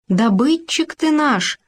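lightly voiced gopher, touched to tears; a hint of a happy sob; tearful gopher. A narrator's reading. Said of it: "прослезится от счастья обжора суслик". (растроганный суслик)

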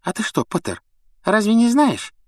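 high mocking sneer; mustache twirling villain. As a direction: bait him with glee. Malfoy taunts Harry.

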